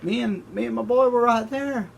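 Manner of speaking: David taunting Abner from across the valley; cocky David. Retold or imitated. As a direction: show off the proof like a buddy. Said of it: "And David's like".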